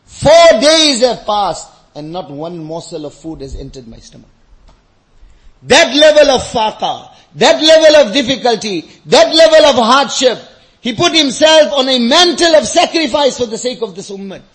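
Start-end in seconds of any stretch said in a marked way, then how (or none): 0.1-1.7 loud, slow and forceful; emphatic repeat. Retold or imitated. Preacher stressing the Prophet's hunger to congregation, emphatic. 5.6-14.4 loud, raised, forceful sermon voice; impassioned preaching. Real, not acted. Preacher telling of the Prophet's sacrifice, impassioned.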